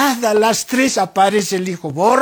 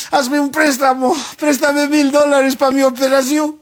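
hammed-up eulogy by the eldest son, higher pitched; fake-teary speech at mother's wake; showy mourning son; 4 semitones higher than usual. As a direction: play the show-off son giving a speech at the funeral. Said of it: "hacen su discurso".

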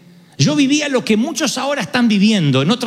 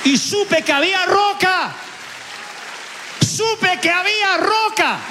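much higher, slow, held-out certainty; a slow, lifted declaration of faith; awed conviction; 11 semitones higher, slower than usual. Real, not acted. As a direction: let it ring out slowly in wonder. Climax of personal testimony.

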